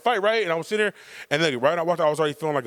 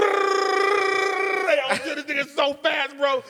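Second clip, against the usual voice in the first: hyped boast; 12 semitones higher, much slower than usual. Retold or imitated. Hyped fight retelling.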